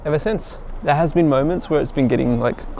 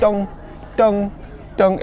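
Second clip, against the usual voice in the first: dong sound effect; 8 semitones higher, slower than usual. Retold or imitated. Imitating heads hitting bars.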